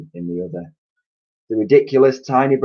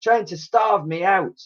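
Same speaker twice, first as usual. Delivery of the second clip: high whiny complaint; self-pitying hag; 10 semitones higher, 4 dB louder than usual. A narrator's reading. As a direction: wail about being starved.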